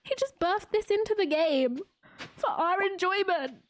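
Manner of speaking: high, moved and choked-up; overwhelmed by the creator's gift; touched, near tears. Real, not acted. Streamer moved by the creator's gift, near tears. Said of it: "I'm gonna cry".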